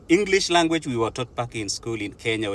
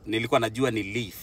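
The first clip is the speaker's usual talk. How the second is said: normal talking voice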